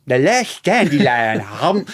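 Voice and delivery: slow, put-on cartoon animal voice; Ice Age. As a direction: stretch it out as a comic movie quote. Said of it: "in my head, I did the, um, Ice Age".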